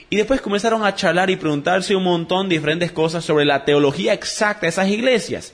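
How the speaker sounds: ordinary talk, nothing marked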